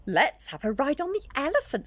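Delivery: excited little skeleton. Acted. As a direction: ask for a ride excitedly. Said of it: "said little skeleton".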